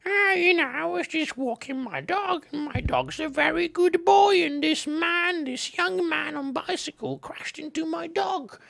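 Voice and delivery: put-on high, far old woman's whine, slowing at evil; mock an offended old lady. Imagined dog owner telling the cops about the crash, in a high whiny old lady voice. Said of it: "She'll be like".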